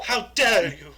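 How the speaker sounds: hammed-up trembling fury; shaking with anger. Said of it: "you gotta get the tremble".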